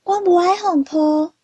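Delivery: high-pitched storybook protest; crying out. A narrator's reading. Leopard cat refuses to be picked up, crying out. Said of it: "阿石连伊大声喊".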